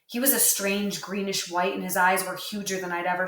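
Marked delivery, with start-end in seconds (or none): none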